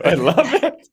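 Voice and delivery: high, laughing "I love it"; laughing delight. Real, not acted.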